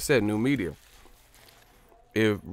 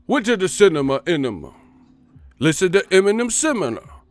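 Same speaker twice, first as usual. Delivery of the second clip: louder, higher nonsense bar; mock rapper line; 8 semitones higher, 6 dB louder than usual. Parodying a bad rapper's line, loud and high. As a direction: toss off a silly bar. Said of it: "you don't rap like [name]".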